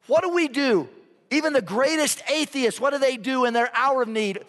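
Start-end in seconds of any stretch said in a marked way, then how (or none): none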